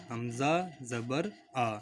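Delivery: حمزہ زبر آ spelled out slowly; drawn out for learners to repeat; slow spelling drill. Shown as a demonstration. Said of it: "اس کو اس طرح سے پڑھیں گے". (آہستہ آہستہ ہجے)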